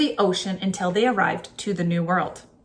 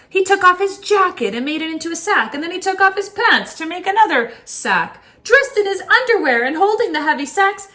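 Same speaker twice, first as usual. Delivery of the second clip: rising higher and louder as greed grows; building excitement; 9 semitones higher, 4 dB louder than usual. A narrator's reading. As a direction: tell it faster and more breathless with each sack. Greedy officer piling up sacks.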